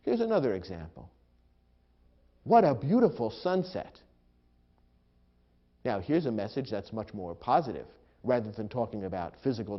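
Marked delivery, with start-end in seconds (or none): 2.4-4.0 high, admiring exclamation; delighted exclamation. Shown as a demonstration. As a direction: marvel at the view aloud. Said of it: "because strong emotion is involved".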